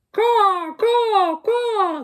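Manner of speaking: high, loud cawing crow calls; crow caws. Acted. Crows cawing as they flee, high and loud. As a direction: caw out like startled crows flying off. Crows fly away saying.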